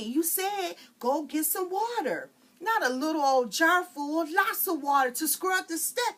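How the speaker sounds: high, loud squabble; kids squabbling. Acted. Frida and her sister squabble over the water jar. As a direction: bicker like sisters.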